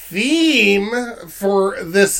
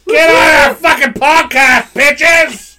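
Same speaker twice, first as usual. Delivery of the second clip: loud high rowdy call-out; 9 semitones higher, 9 dB louder than usual. Real, not acted. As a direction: yell it at absent friends. Drunk shout-out to friends.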